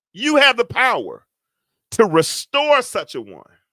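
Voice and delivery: hollering sermon voice; full-force preaching. Real, not acted. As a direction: preach it out to the congregation, building.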